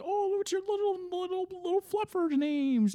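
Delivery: put-on very high cooing baby-talk voice; baby talk to a dog. Guests cooing at a fluffy dog, high baby talk. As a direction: coo at the dog in a squeaky high voice. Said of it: "they're like".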